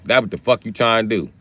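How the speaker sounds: ordinary talk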